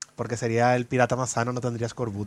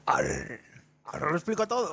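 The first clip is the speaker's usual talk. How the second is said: pirate growl; 6 semitones higher than usual. Pretend. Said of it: "Día Internacional de Hablar como un Pirata".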